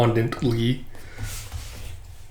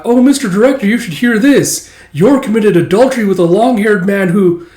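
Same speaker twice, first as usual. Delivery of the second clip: high, loud, scandalized report; mock tattling; 8 semitones higher, 6 dB louder than usual. Coworker telling the director about Yor, mock scandalized. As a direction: tattle eagerly to the boss.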